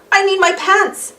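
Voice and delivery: high, panicky plea; panicked cry. Acted. The amoeba Arthur begs for his pants in front of everyone, high panicked cry. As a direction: beg for your pants in a panic. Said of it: "cried Arthur Amoeba".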